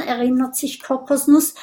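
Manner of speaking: ordinary delivery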